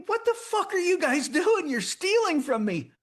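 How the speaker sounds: angry yell. Retold or imitated. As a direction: bellow at the thieves, outraged.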